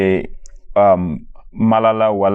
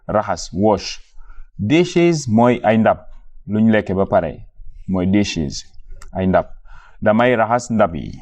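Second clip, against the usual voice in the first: usual speaking voice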